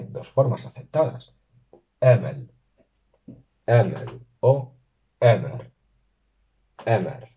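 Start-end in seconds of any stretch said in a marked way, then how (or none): 2.0-4.7 "Emel" stressed on the first syllable; teacher modelling the Sindarin for mother; Sindarin word. Shown as a demonstration. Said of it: "la tónica va a recaer en la primera sílaba". 5.2-7.3 "Emer" stressed on the first syllable, tapped r; teacher modelling the second form; Sindarin word. Shown as a demonstration. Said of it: "la tónica va a recaer en la primera sílaba".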